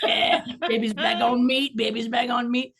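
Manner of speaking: high, sing-song victory chant, imitated; husband's gleeful celebration. Husband celebrating his wife eating meat again, imitated gleeful chant. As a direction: crow it like a mock dancing husband. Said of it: "hear him in the background, like, doing the dance".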